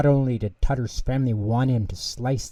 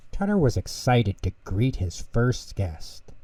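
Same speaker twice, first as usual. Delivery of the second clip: usual speaking voice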